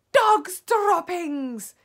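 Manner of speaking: slow, drawn-out high yell. A narrator's reading. Witch announcing what she smells, yelling. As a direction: yell it out, stretching both words. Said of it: "she yelled".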